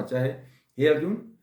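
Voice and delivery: usual speaking voice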